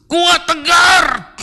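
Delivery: loud, high, drawn-out cry of toughness; emphatic, held-out shout; forceful tough shout. Retold or imitated. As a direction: shout it like a command to be strong. Father's stoic toughness, shouted.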